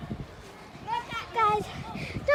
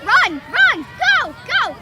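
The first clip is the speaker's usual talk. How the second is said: loud rising cheer, higher on sprint; 4 semitones higher, 10 dB louder, slower than usual. Real, not acted. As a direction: urge the runner on. Cheering on a runner.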